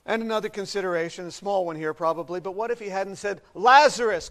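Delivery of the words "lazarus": loud mock summons; sudden loud shout of a name; calling out. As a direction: punch the name out like a call into a cave. Acts out Jesus calling the name.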